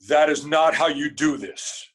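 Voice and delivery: loud, commanding correction; bellowing professor. Retold or imitated. Professor correcting a nervous auditioning student, bellowing. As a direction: bellow the reprimand like a stern professor. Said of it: "big bellowing voice".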